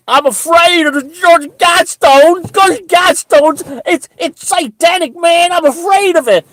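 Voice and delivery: squeaky high falsetto whine, loud; mocking a terrified follower in a squeaky falsetto; mock scared believer. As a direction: ham up a frightened sheep.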